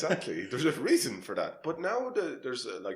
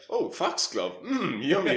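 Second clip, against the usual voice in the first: high, louder mock delight; naive forager; 9 semitones higher, 7 dB louder, slower than usual. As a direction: gush hungrily like a clueless amateur forager.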